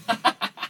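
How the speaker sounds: soft, breath-only chuckle; real little laugh; short laugh. Real, not acted. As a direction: chuckle quietly after the punchline. Reaction to own flat joke.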